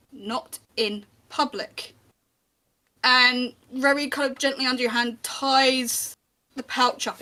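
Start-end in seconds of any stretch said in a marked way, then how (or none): 0.1-1.9 a slow, hushed warning; a hint of a low warning from the watchman; gentle warning. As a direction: quietly stop him, slowly and gently. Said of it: "he gently kind of puts his hand".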